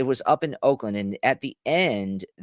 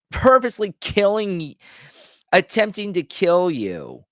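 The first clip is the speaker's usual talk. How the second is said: ordinary talk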